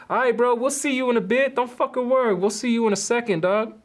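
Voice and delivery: imitating a thug backing off with a threat; sneering see-you-later; menacing taunt. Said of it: "and he's all".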